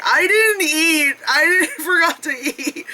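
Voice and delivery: high, slow mock whimper; half-laughing fake despair; mock whine. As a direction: whine like a starving tourist. Realizing she forgot to eat.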